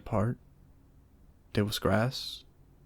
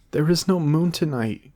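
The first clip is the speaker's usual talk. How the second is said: higher, louder desperate cry; cried out; 7 semitones higher, 9 dB louder than usual. A narrator's reading. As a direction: call out to stall. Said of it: "cried Maga".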